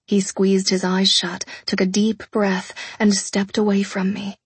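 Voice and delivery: plain reading voice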